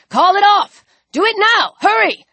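high, pressing shout; urgent command. Acted. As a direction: order it done this instant. Rifleman orders dog called off.

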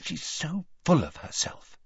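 hushed, confiding aside; dropped voice. Acted. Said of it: "dropping her voice".

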